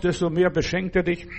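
usual speaking voice

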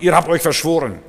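put-on loud, accusing young man's outburst; angry accusation. Young man scolds the preacher on the square, loud and accusing. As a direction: bark the charge at the preacher. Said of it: "schimpft mich aus".

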